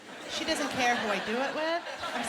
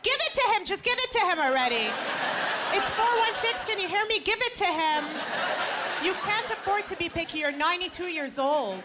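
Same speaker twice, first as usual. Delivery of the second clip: screaming mom; 5 semitones higher than usual. Retold or imitated. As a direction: shout at her daughter through the phone. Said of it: "my own mother screaming into the phone".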